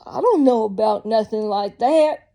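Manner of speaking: mock the Walmart worker in an over-the-top Southern accent; bad Southern accent. Mocking Walmart staff who won't answer, in a loud Southern accent. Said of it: "the worst Southern accent ever".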